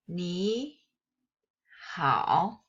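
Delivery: "ni, hao" said as Mandarin syllables with their tones; Chinese ni hao. Shown as a demonstration. Teacher reading two characters aloud in Mandarin tones. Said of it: "when you write down two characters".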